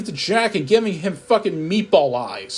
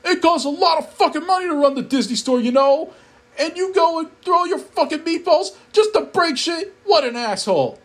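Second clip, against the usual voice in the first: high-pitched furious rant, far from own voice; angry Jack; 11 semitones higher than usual. Acted. Jack Skellington berating the narrator through the screen, high angry rant. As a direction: rant like a cartoon villain scolding.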